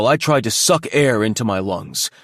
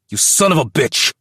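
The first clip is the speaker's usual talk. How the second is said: loud, higher enraged yelling; furious threat; 7 semitones higher, 5 dB louder than usual. A narrator's reading. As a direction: spit the threats out in a rage. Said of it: "as he spat in my face".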